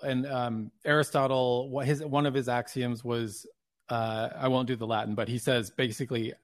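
ordinary talk, nothing marked

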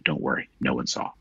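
hushed reassurance, lightly imitating a whisper; quiet, low mock whisper to the dog; retold whisper. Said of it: "and then whisper to him".

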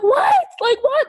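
very high, squealed disbelief; stunned outburst, voice shooting way up; shocked. Retold or imitated. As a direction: reenact a shocked, high-pitched "what". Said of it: "I was so shocked".

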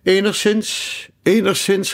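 mildly nervous, high-pitched reply; a little nervous. A narrator's reading. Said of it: "gaf de koning een beetje nerveus ten antwoord".

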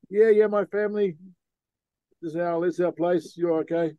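a hint of a friendly local's voice; local man answering. Local in a canoe tells the sailor it's fine, lightly voiced.

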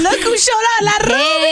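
high, gleeful welcome; excited intro of Ruby; excited announcement. Real, not acted. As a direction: hype her entrance. Host announces co-host.

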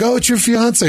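high-pitched imitation of a crying girl; mock tearful. Ex meets the fiance, mock tearful high voice. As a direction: pitch up for a put-on upset reaction. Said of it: "And she starts crying".

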